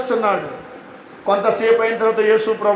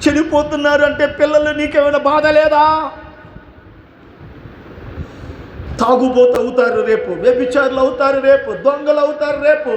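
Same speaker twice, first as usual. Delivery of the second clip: fiery preaching; 4 semitones higher than usual. Acted. Preacher rebuking careless parents, fiery shouting sermon. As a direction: berate the parents from the pulpit, climbing.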